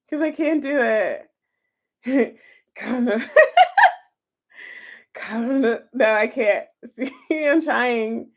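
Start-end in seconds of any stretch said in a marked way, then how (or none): 2.7-3.2 laughing through the words; giggling, can't keep a straight face. Real, not acted. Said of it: "you guys make me laugh". 5.1-5.8 laughing harder. Real, not acted. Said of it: "you guys make me laugh". 5.9-6.7 giggling while refusing; laughing, unable to go on. Real, not acted. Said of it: "you guys make me laugh".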